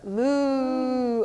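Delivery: slow playful moo; cow moo. Shown as a demonstration. Teacher mooing like a cow for a child in cat-cow. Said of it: "You do it".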